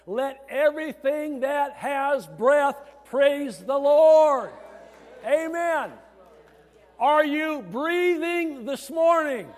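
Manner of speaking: slow, high shout, each word stretched out; full-force preaching. Real, not acted. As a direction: shout it to the whole congregation. Psalm climax shouted to congregation.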